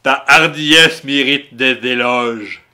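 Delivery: slow theatrical villain menace. Acted. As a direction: drag each word out like a dark lord. Reads villain's lines dramatically.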